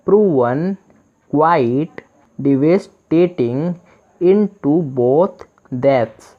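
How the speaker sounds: English read out very slowly, word by word; teacher dictating a sentence; slow reading aloud. Shown as a demonstration. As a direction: read it slowly so learners can follow.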